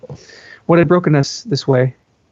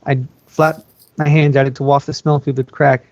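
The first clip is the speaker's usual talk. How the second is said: plain reading voice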